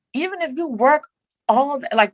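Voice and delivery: normal talking voice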